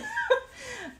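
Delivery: high, breathy giggle. Real, not acted. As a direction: giggle helplessly. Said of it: "so distracted".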